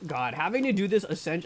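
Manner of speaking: ordinary delivery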